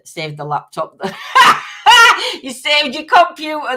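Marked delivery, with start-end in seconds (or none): 1.0-2.4 high loud cackling laugh; loud burst of laughter. Real, not acted. Laughing out loud at a viewer's laptop mishap.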